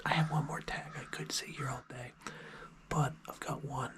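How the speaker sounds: hushed field whisper, keeping quiet for game; hunting whisper. Real, not acted. Hunter out in the field, whispering to the camera. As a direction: whisper so nothing in the woods hears.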